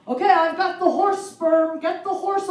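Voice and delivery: loud, slow teasing doctor voice; mock doctor. Doctor teasing the patient in stirrups, loud and slow. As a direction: announce it like a prankster doctor. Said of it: "he walks in the room and goes".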